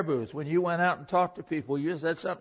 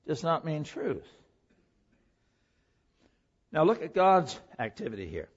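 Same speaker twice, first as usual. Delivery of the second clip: ordinary talk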